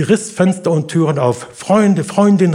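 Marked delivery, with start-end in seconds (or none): 1.5-2.5 high, loud call for friends; gerufen. A narrator's reading. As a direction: call out for help, raised voice. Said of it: "rief sie". (lauter, hoher Hilferuf)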